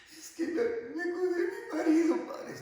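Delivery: high-pitched wailing imitation of a weeper; mock crying. Widow explaining to the priest why she cries, hammed-up sobbing. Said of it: "que siempre están llorando".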